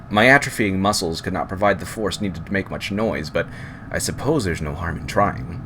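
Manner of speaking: usual speaking voice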